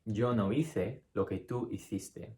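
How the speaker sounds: slow Castilian read, hice and hiciste with a th; Spain Spanish. Shown as a demonstration. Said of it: "How would you pronounce it in Spain?".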